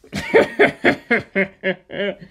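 a long amused laugh; chuckling at his own lie. Acted. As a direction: laugh it off, pleased with yourself. Laughing after the lie story.

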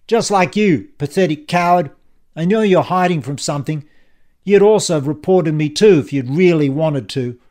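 loud, forceful bully; contemptuous villain. Acted. Ian turns on Dan as a coward, loud and contemptuous. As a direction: lash out with open contempt.

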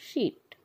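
शीट said as sheet with a curled-tongue sh; curled sh sound. Shown as a demonstration. Teacher demonstrating शीट for the class. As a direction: begin शीट on a clear turned sh. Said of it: "जीभ को थोड़ा सा turn करके बोलेंगे".

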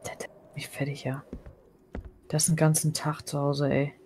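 breathy whisper under her breath. Real, not acted. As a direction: whisper so no one hears. Muttering about another player.

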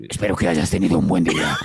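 low, firm parody of a threat; mock threat. Friend parodying the scary voice message as a threat, low and menacing. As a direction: drop the pitch and voice it fully, ominous. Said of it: "Es como una amenaza".

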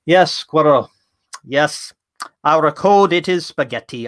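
a relaxed coder's attempt at a Bulgarian accent, slow on the big lines; playful Bulgarian accent impression; mock Bulgarian accent. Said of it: "I can't do a Bulgarian accent, but I'll do the closest I can".

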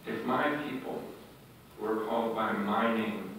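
hushed, reflective reread; soft repeat. Shown as a demonstration. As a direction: quietly lean on "my people". Repeats verse softly.

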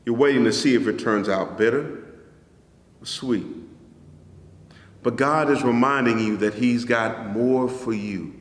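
usual speaking voice

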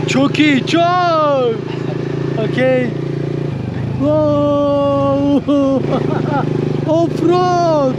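excited over rough road; on a bumpy road. Real, not acted. Riding off-road, excited shouts.

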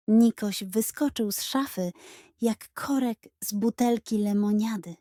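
ordinary delivery

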